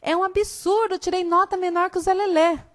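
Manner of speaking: a light Chico Bento comic-strip voice; complaining country boy. Retold or imitated. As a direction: protest like a cheeky rural schoolboy. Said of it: "ele diz assim".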